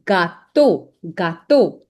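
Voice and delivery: "gâteau" said ga-TOH with EAU as O; French pronunciation demo. Shown as a demonstration. Teacher shows the EAU sound, gâteau pronounced ga-toh. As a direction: say "gâteau" as two syllables ending in a pure O. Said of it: "E A U gives the sound of O".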